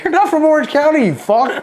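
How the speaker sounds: very high-pitched mock outrage; indignant retort. Boot challenging shirt thief, mock indignant. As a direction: protest in disbelief, laughing at the end. Retold quarrel over stolen shirt.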